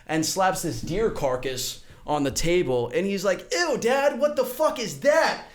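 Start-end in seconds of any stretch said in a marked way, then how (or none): 3.5-5.4 high, drawn-out revulsion; disgusted son. Acted. Son sees deer carcass slapped on the table, disgusted. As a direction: recoil in mock disgust, pitch jumping up.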